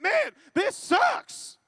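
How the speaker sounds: high, drawn-out cry of complaint; exasperated admission. Acted. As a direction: blurt it out, dragging each word. Acts frank admission of misery.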